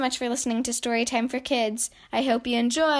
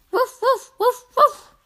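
high playful puppy woofs; puppy barking; 12 semitones higher, 8 dB louder, slower than usual. Pretend. Narrator voicing Biscuit the puppy for kids, high woofs. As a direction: bark like a happy little puppy.

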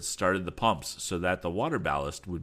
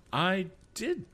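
surprised; 13 semitones higher than usual. Acted. Tom answering puzzled.

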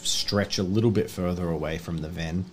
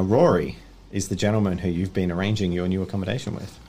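ordinary talk, nothing marked

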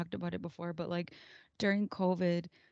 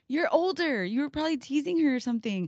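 higher-pitched mock telling-off; scolding parent; 7 semitones higher, 4 dB louder than usual. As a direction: imitate a mom reproving her teen. Retells scolding her older daughter.

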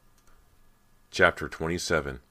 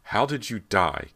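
loud, higher, slow challenge; angry demand; 4 semitones higher, 6 dB louder than usual. A narrator's reading. Callie challenging Brant about his death, angry. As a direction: challenge him, stumbling on the first word. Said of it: "Callie demanded angrily".

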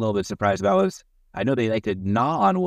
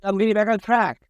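raised, higher insistent call; yelling; 9 semitones higher than usual. Real, not acted. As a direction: shout it back on track. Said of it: "Please, please yell it louder".

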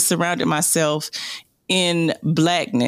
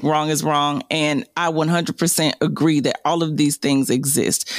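ordinary talk, nothing marked

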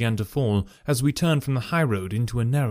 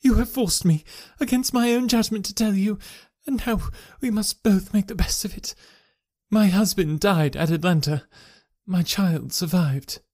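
a higher woman's voice, dignified; proud wife; 7 semitones higher than usual. A narrator's reading. Wife revealing her past to her husband, higher and dignified.

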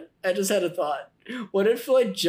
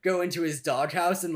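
usual speaking voice

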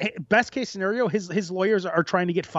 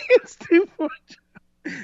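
laughing; 4 dB louder, slower than usual. Real, not acted. Laughing through the line.

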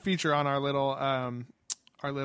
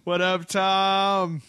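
much higher, louder, very slow greeting; drawn-out shout out; 5 semitones higher, 6 dB louder, much slower than usual. Real, not acted. Host greeting Australian listener Tom, slow loud call. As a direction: stretch it out like calling across a room.

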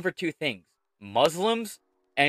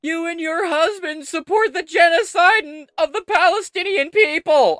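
very high, loud falsetto shouting; hammed-up screech of an outraged protester; mock demonstrator yell; 8 dB louder than usual. Host reading out a demonstrator's quote, mock high-pitched yelling. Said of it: "Yelled one demonstrator".

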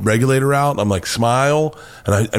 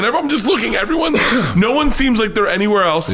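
excited; 11 semitones higher, faster than usual. Real, not acted. Excited retelling of the dive.

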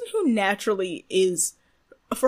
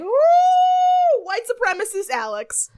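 high squealing mock title; mock announcement; 11 semitones higher, 6 dB louder, slower than usual.

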